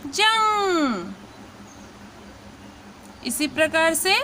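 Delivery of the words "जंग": जंग stretched very slowly, nasal ng held; slow teaching demo. Shown as a demonstration. Teacher showing students how to say जंग, very slow. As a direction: draw out जंग for learners. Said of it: "तो उच्चारण होगा".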